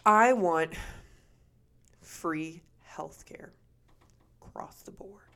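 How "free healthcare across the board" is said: whispering. Real, not acted. Wishing for free healthcare.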